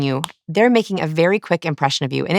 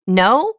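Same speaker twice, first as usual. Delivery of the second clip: "No" sliding steeply up into a question; question inflection; 5 semitones higher than usual. Retold or imitated. Imitating a daughter denying the cookie theft, "No" rising like a question. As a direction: deny it with "No" rising high at the end. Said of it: "And she goes".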